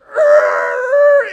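loud high-pitched screech noise; imitated car screech. Friend imitating the Explorer slamming in, loud screech. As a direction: screech like skidding tyres.

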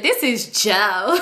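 slow, drawn-out mimicry of the girl; mocking imitation. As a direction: stretch it out to make fun of her. Mocking girl announcing her caller.

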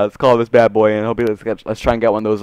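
ordinary talk

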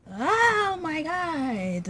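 high, long drawn-out exclamation; exasperated wail. Real, not acted. Girl venting about her stolen first kiss, exasperated wail. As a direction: stretch it out in a rising-then-falling cry.

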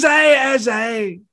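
high, hearty mock greeting, easing off; Billy Connolly. Famous comedian turning round to two fans, hailing them. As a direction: answer a stranger's call as the famous comedian. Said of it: "He goes".

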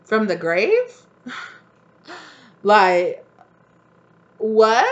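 shocked disbelief. Retold or imitated. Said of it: "And they're like".

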